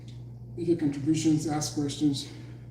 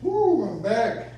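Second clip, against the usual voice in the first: cheerful; 5 semitones higher, 3 dB louder, much slower than usual. Real, not acted. Returns from break.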